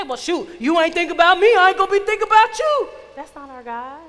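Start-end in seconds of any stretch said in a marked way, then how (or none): 0.0-2.9 loud, high mock indignation; petulant retort. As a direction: fire back like someone slighted, much louder and rising. Mock God refusing, petulant retort.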